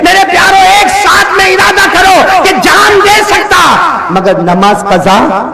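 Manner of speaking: high, loud, rousing sermon cry; rally the crowd with every line; preaching at full force. Acted. (مجمع کو جوش دلاتی ہوئی بلند آواز تقریر)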